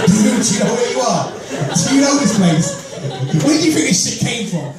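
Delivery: high, laughing comeback; laughing retort. Retold or imitated. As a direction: laugh him off, incredulous and high. Retort to racist at museum.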